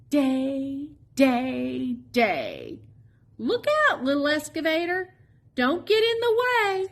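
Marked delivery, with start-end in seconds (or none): 3.4-6.9 high alarmed warning; high urgent look-out call. Acted. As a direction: call out to stop him in time. Warning little excavator.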